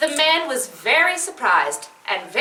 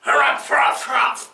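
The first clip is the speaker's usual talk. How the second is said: harumphing. Acted. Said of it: "The man harumphed".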